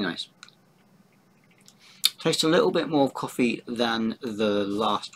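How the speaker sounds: talking while eating; biting into a snack bar; mouth full, chewing. Real, not acted. Seen 2: Bites wrapped chocolate wafer bar at mouth, then talks holding it.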